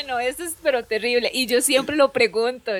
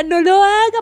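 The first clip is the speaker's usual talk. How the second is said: imitación de madre angustiada, en tono agudo; súplica casi llorando; 8 semitones higher, 4 dB louder than usual. Retold or imitated. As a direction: ruega como mamá al borde del llanto. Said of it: "casi que lloraba y me decía". (súplica llorosa fingida)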